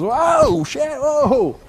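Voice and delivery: shrieking, very high and loud whoa; big startled reaction. Retold or imitated. Decoy panicking as the dog bites his hand, loud shriek. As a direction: overreact like a spooked decoy. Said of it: "A big reaction".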